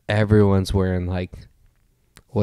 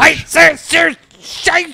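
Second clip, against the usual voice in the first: much higher, louder hammed-up German ranting shout; mock Hitler rant; 4 dB louder, much slower than usual. Mocking German as angry, hammed-up ranting shout. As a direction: parody a raging dictator's speech. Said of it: "if you watch those fucking Hitler videos".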